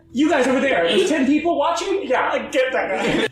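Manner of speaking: loud mock alarm; calling out. Someone pointing out intruders to others, calling out. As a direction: shout across the room, half laughing. Acted bystander calling out an order.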